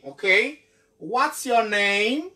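ordinary talk

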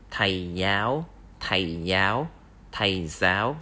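"thầy giáo" repeated with a rising end on "giáo"; Vietnamese thầy giáo. Shown as a demonstration. Teacher modelling the word for a male teacher. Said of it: "a male teacher is".

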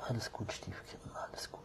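whispered reassurance. Real, not acted. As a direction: hush the kitten softly. Soothing a shy kitten.

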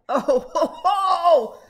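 loud high burst of laughter; laughing. Real, not acted. Laughing at next page.

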